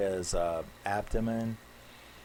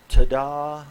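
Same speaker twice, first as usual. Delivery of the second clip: mock ta-da; 6 semitones higher, 14 dB louder than usual. Big reveal announced mockingly.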